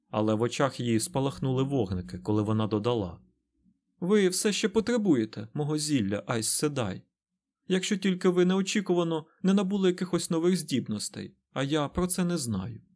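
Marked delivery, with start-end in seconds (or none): 4.0-12.8 higher-pitched, pointed defiance; challenging. A narrator's reading. Nynaeve needles the Aes Sedai about herbs, defiant. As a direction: throw it out as a challenge. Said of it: "Вона промовила це з викликом".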